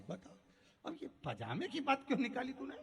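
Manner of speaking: angry. Acted. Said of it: "फिर गुस्सा आ गई".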